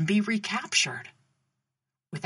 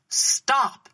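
loud, higher-pitched guard's order; raised voice, giving an order; commanding shout; 5 semitones higher, 5 dB louder than usual. A narrator's reading. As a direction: call out a sharp command to halt. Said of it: "he commanded".

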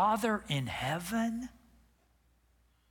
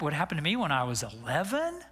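normal talking voice

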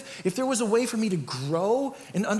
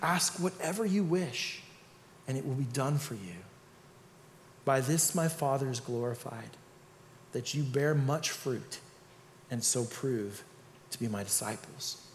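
scripture reading; 7 semitones lower, 8 dB quieter than usual. Acted. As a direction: read the verse gently and reverently. Reads scripture passage.

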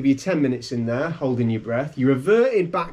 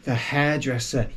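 normal talking voice

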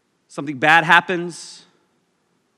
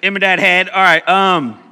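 loud laughing call; 2 semitones higher, 8 dB louder than usual. Shown as a demonstration. Repeats joke name loudly.

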